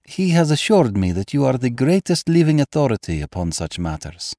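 a hint of a refined lady; simple answer. A narrator's reading. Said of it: "she answered simply".

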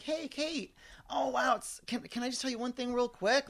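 high, lilting and extra friendly, shoulders up. Retold or imitated. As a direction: sweeten every word in a sing-song.

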